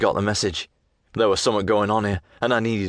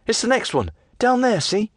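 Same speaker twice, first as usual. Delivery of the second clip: high, light lass voice; young woman's voice; 13 semitones higher than usual. Acted. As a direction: voice her pleading, pitched up. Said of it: "she says".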